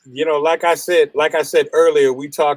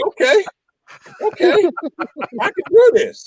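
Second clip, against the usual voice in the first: nervous self-pep talk; 12 semitones higher than usual. Retold or imitated. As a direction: talk yourself into it, pitched high. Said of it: "I was like".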